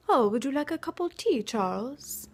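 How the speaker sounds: put-on offering tea in a playful British accent; mock British accent. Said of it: "with, like, a British accent".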